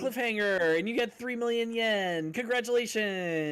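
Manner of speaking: high, upbeat prize-announcer voice; cheery announcer. Pretend. Player announcing a cliffhanger and prize money, cheery announcer. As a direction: announce it high like a big win.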